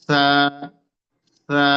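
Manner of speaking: সা with a light breath of air; light airy sa. Shown as a demonstration. Teacher showing the breath on সা. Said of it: "একটা বাতাস পাবেন আপনি হালকা করে".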